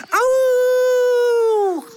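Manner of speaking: sudden high ouch, loud; puppy's startled ouch; high yelp of pain. Acted. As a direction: yelp as if hurt. Dog bumps into something.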